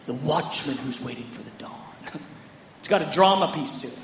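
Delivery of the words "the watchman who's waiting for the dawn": soft, lower, leaning on watchman; a hushed stress on "The watchman who's waiting for the dawn"; emphatic repeat. Retold or imitated. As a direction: dwell on "watchman" quietly. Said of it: "he emphasizes".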